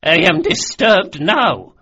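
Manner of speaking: high, loud, slow indignation; spitting anger. A narrator's reading. Old noblewoman to the intruder who disturbed her, spitting angrily. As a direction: spit it out loudly, pitched high. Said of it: "she spat".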